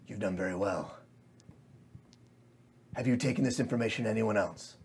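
low, soft, silky villain voice; sinister Fae master. Acted. Mysterious Fae quietly questions his pixie spy, low and menacing. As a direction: coldly praise the servant before the kill.

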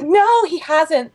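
high, drawn-out denial; lifted, exaggerated reassurance; playing along. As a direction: humour grandma with a long "no". Retold friend playing along.

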